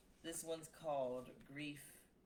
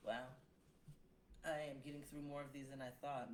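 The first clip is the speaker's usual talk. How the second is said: usual speaking voice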